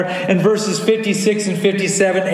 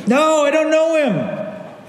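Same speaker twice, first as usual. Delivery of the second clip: Peter denying; 8 semitones higher, slower than usual. Acted.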